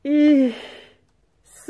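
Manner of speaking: high, loud squeal of delight; excited shouting. A narrator's reading. Sultan's wives spotting a boy, excited shouting. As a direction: cry out high like cooing women. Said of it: "råbte de".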